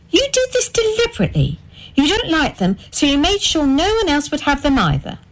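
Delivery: high, fast, rising accusation; high pitched wounded fury; furious accusation. A narrator's reading. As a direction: hurl the blame at him. Wife accuses husband of sabotage.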